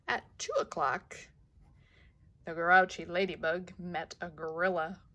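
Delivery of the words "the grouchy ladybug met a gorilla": ordinary delivery, nothing marked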